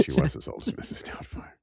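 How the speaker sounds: whispering. Real, not acted. Whispered aside repeating line.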